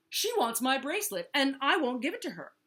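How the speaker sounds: loud, high, scornful refusal; sneering. A narrator's reading. Vulture complains to Walrus about Turtle, sneering. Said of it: "sneered Vulture".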